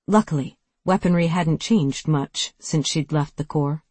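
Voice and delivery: plain reading voice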